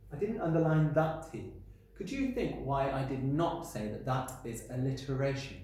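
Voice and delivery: usual speaking voice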